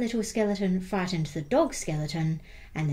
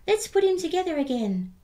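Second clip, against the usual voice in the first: much higher, louder little skeleton voice; squeaky and keen, like a small child; 10 semitones higher, 4 dB louder than usual. Acted. Little skeleton proposing to fix the dog, high and eager. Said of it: "the little skeleton said".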